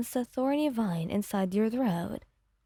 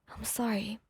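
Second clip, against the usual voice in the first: faint whisper held back from a sob; choked whisper; 6 dB quieter than usual. A narrator's reading. Apologizing to both partners, whispering through a held-back sob. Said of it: "You choked back a sob".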